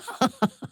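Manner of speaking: a quick scornful laugh; a brief laugh, pitched high; short high laugh. Acted. A dark elf laughing at the council of five. Amused laugh after mocking line.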